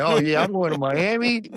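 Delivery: light up at the good news; delighted. Retold or imitated. Joy at going to Miami.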